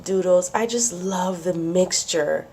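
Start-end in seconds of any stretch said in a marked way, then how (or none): none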